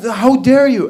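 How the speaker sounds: loud, high mock outrage; indignant. Wife confronting her husband, loudly indignant. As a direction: take offence, imitating a scandalised wife.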